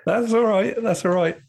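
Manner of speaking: squeaky high reply; high-pitched. Real, not acted. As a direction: jump way up in pitch.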